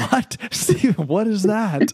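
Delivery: high, slow, puzzled laugh; incredulous, drawn out; amused disbelief. Real, not acted. Friend teasing about Jesus muscle guys.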